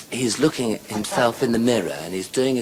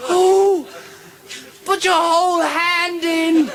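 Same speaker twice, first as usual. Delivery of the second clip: very high, loud, drawn-out cry; slow, squealed urging; woman in ecstasy; 5 dB louder, slower than usual. Acted. Woman demanding more of her lover, high ecstatic cry.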